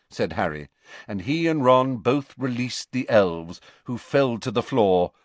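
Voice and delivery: usual speaking voice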